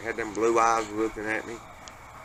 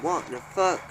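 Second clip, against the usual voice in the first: higher, stunned curse rising at end; shocked exclamation; 6 semitones higher than usual. Retold or imitated. Dad spits out his beer at the moving glass, shocked. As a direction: blurt it out in disbelief.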